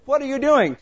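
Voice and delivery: high, loud startled cry; jolted awake and alarmed; startled question. Retold or imitated. Waking to his wife staring, startled and loud.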